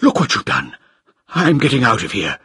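high-pitched cry of alarm; villain suddenly panicking; panicked scream. A narrator's reading. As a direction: cry out in horror, desperate to flee. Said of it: "and screamed".

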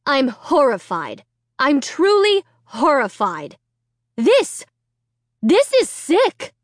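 horrified scolding mother; disgusted, rising outrage; appalled. A narrator's reading.

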